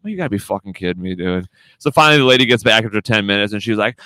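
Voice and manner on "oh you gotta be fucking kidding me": ordinary delivery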